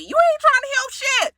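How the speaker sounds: high-pitched heated accusation; worked-up yelling. Real, not acted. Viewer calling out the pot stirrer, worked-up yelling. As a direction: let the pitch leap in indignation. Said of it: "Am I yelling?".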